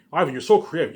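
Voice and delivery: gushing praise, up high and loud. Retold or imitated. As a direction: play a fan gushing over him. Fans praising him.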